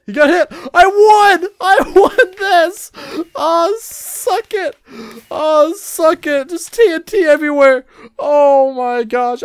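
very high loud slow whoops of victory; ecstatic laughing cry. Real, not acted. Gamer shrieking with joy after an unexpected win.

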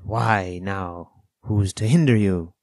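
sly castaway, perking up; startled slyness. A narrator's reading. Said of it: "perked up into a kind of startled slyness".

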